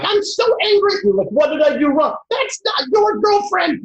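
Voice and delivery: over-the-top mom yelling at her son; furious mom voice. Acted. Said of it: "You'd be like".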